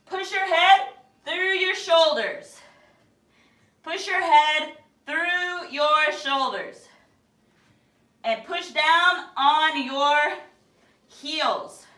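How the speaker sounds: projected slow instruction. Real, not acted. Coach guiding down dog, slow drawn-out call. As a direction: stretch each word out for the class.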